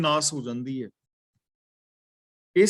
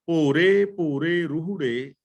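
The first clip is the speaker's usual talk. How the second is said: scripture recitation; slower than usual. Acted.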